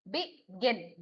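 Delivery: Begin in two parts, landing on गिन; stress on second syllable. Shown as a demonstration. Teacher repeating the example for students, stress on गिन in begin. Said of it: "गिन stress है".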